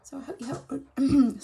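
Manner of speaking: hoarse, croaky voice; real throat croak, a bit rough. Real, not acted. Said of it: "croaky voice".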